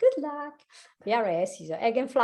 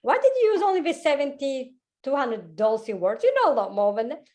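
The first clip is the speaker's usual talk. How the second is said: higher, mock self-scolding; scolding herself; 5 semitones higher, 4 dB louder than usual. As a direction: tell yourself off, half laughing. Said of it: "I look at myself and I said".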